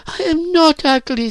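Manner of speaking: put-on very high, wounded girl's voice; hurt protest. A narrator's reading. Virginia answers Phyllis's insult, hurt. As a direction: protest it high-pitched, stung.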